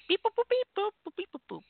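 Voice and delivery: high beeping robot voice, fading out; robot beeps. Pretend. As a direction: chirp like a little robot. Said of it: "I don't know why I'm doing that".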